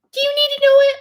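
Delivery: put-on very high, loud baby-talk voice. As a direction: coo it way up high to a pet. Turns to baby-talk to pet.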